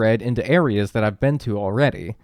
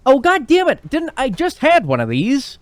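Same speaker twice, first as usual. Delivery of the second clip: high, loud frustrated yell; exasperated shout; 8 dB louder than usual. Real, not acted. Frustrated at repeated fish request.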